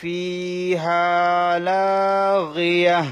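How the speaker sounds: slow, drawn-out melodic Quran chant; slow chanted Quranic Arabic for a tajweed class; Quran recitation. Shown as a demonstration. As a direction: recite it slowly with tajweed and stop on the last word. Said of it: "আবার বলতেছি আমি".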